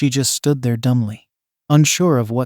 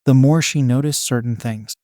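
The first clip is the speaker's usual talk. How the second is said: ordinary delivery, nothing marked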